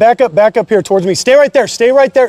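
loud, high, rapid-fire repetition; real urgent police yell; urgent shouted command. Real, not acted. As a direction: freeze a suspect with your voice. Officer directs suspect during standoff.

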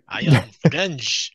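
put-on slow, hammy French accent; comic sitcom Frenchman, drawn out; Gordon Kay's French accent. Guessing who plays the Frenchman, mock French accent. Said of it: "doing his French accent".

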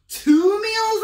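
high, loud gleeful wolf; greedy delight. Acted. Hungry wolf gloating over his next two meals. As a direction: savor the thought of two meals.

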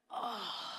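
long, drawn-out "oh" of compassion; compassionate groan. Acted. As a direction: let out a soft gut-felt groan. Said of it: "had such compassion on this woman".

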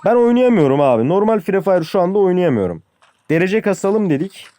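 real frustrated shout at the game; frustrated outburst. Real, not acted. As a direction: vent that you can't play anymore. Frustrated player venting loudly.

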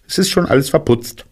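high, quick rabbit voice; brisk high-voiced report; rabbit character. A narrator's reading. Rabbit shows bear the empty pots, high voice. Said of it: "Kaninchen hob den Deckel".

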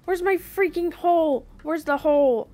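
high, loud frantic yells; panicked yelling. Real, not acted. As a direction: scream it while fleeing. Fleeing, searching for hole.

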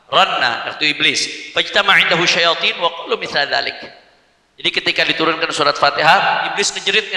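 usual speaking voice

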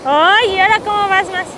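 loud high teasing call; excited shout. Real, not acted. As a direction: shout out, thrilled and laughing. Wife watching husband in waves.

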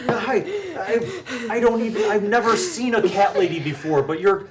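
high, loud giggly flattery; laughing fawning. Acted.

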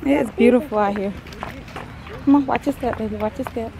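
normal talking voice

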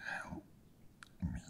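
slow, very soft rasp, forced out word by word; strange strangled rasp. A narrator's reading. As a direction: rasp it faintly as if being strangled. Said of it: "in a strange voice".

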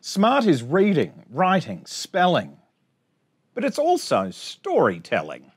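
bouncy sing-song rhyme reading; reading to children. Acted. Teacher reading a rhyming picture book to her class, sing-song. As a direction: read the rhymes out brightly for little kids.

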